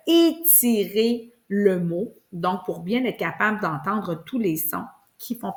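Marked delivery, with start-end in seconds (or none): 0.1-2.1 "étirer" pulled out slowly, syllable by syllable; stretched out. Shown as a demonstration. Teacher explaining the elastic trick, slow and stretched. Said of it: "c'est le truc de l'élastique".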